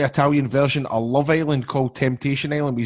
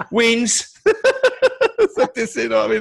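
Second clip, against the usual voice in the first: laughing; 9 semitones higher, 3 dB louder, slower than usual. Retold or imitated. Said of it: "looked at Lorenzo Amoruso and went".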